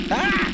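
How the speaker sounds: sudden loud high yelp of pain. Real, not acted. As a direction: yelp as something hits you. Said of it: "Why'd you have to throw the cactar at me?".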